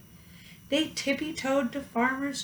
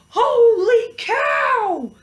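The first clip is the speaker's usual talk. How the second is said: high, loud, slow alarm; fire chief yelling; 8 semitones higher, 6 dB louder than usual. A narrator's reading. Fire chief yelling to his men, high and loud. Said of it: "The chief yelled".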